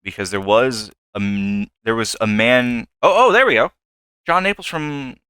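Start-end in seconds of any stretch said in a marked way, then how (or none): 3.0-5.2 excited, higher and louder burst; "oh, oh" jumping up in sudden delight; pleased discovery. Real, not acted. Player spotting the name on the list, excited. Finds the name being searched for.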